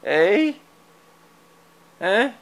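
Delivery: high questioning coo at the cat; pet talk. Real, not acted. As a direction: tease the cat with a high prompt. Teasing the cat.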